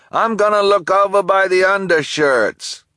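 high, loud announcement; loud call. Acted. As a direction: call it out across the store. Delivery person calls out.

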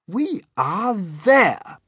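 high, loud, very slow announcement; grand high-pitched cheerfulness; trying to be cheerful. Acted. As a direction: proclaim it with stretched-out cheer. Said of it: "trying to be cheerful".

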